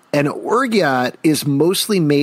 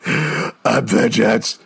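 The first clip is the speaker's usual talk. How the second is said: slow, drawn-out mock Batman growl; mock Batman line; much slower than usual. Reading out a Batman catchphrase, slow mock gravel. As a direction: drag out I'm vengeance like a brooding hero. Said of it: "then say your vengeance".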